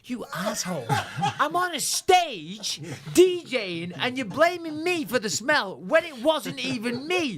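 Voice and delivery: high, laughing mock outrage; retold indignant protest. DJ retelling his protest to the clubgoers, high and half-laughing. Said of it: "I'm like".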